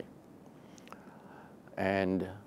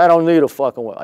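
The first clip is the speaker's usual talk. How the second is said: scoffing retort; 7 semitones higher, 10 dB louder, faster than usual. Retold or imitated. Manager scoffing, retold.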